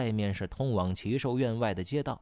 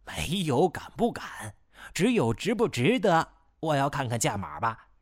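cocky; 5 semitones higher than usual. Acted. Thief answering coolly.

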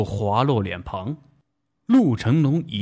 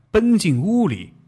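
ordinary delivery, nothing marked

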